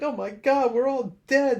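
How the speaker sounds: high, loud mock panic; panicked cartoon character. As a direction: squeal it like a doomed cartoon soldier. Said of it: "it's like".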